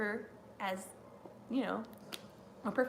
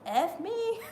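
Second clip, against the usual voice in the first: high, laughing curse; laughing retold curse; 9 semitones higher than usual. Retelling a wrestler's curse before his entrance, laughing. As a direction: blurt it through a laugh. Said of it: "he said".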